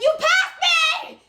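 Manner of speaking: high, loud, drawn-out holler; mock outraged yell. Caller as officers drive past her, mock outraged yell. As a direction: stretch each word in a shrieked protest.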